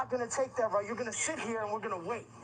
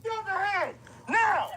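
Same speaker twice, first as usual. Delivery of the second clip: shouted command; 7 semitones higher than usual. Real, not acted. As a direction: bark the order at the suspect. Officer orders suspect at gunpoint.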